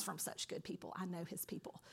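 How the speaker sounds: a gossipy whispered aside; mock gossip in a whisper.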